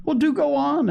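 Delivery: put-on high-pitched boyish plea to continue; impatient, lightly acted child; eager urging. A narrator's reading. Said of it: "in a dreadful hurry for the stories".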